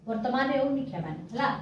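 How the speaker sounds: usual speaking voice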